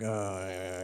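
imitating a groaning class; low, soft mock groan. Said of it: "hear the groan rippling across the room".

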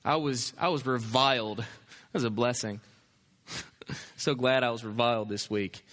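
low, understated mock testimony. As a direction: say it as a deadpan pretend testimony. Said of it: "it's weird for us to talk that way".

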